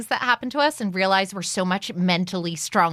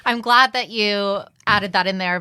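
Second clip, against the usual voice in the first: ordinary talk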